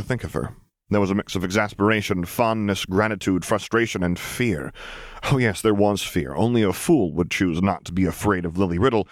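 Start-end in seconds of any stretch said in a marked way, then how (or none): none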